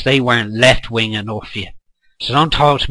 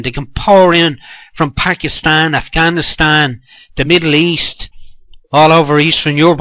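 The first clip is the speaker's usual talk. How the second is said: usual speaking voice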